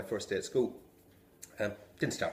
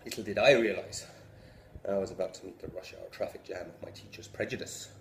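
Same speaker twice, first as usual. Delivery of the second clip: usual speaking voice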